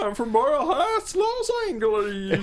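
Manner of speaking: high, squeaky helium voice. Retold or imitated. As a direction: talk like you sucked helium. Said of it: "Hamsar on helium".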